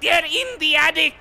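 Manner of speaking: put-on high, loud German accent. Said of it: "you were gonna say in a German voice".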